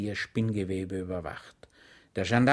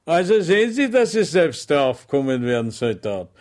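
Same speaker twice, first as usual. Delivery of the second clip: much higher, louder sugary welcome; sergeant's mock welcome; 5 semitones higher, 9 dB louder than usual. Sergeant welcoming Schweig with exaggerated friendliness, higher and louder. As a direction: greet him with false warmth.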